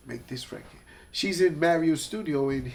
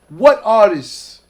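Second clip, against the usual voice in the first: puzzled demand; 4 semitones higher, 10 dB louder, much slower than usual. Retold or imitated. Irv puzzled on phone, retold.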